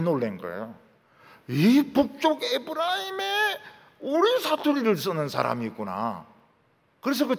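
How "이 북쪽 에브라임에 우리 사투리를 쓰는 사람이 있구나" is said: amazed exclaim. Acted. Danites amazed at young man.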